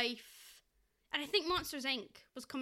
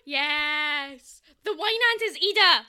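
excited shout; 12 dB louder, slower than usual. Real, not acted. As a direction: yell it like a breakthrough. Sudden realization, both excited.

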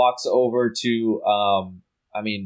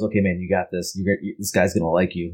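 lower, muttered pep talk to himself; inner pep talk; 9 semitones lower than usual. Retold or imitated. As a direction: talk yourself up, low and under the breath. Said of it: "kinda just goes".

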